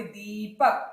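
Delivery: दीपक with a stretched दी; long slow दी before पक. Shown as a demonstration. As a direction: draw out the ई in दीपक. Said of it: "लंबी की ओर जा रही है".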